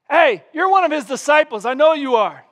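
high, loud and insistent; insistent accusation. Retold or imitated. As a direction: press him hard, sure of it.

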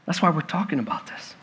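ordinary delivery

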